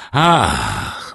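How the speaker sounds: ordinary delivery, nothing marked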